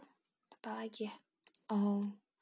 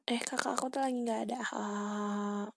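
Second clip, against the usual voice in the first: ordinary talk, nothing marked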